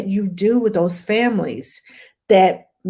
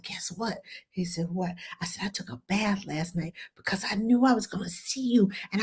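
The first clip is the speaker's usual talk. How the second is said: hushed, whispered retelling; retold confiding; 12 dB quieter than usual. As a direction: whisper it like a secret to a small child. Retold confiding exchange with child.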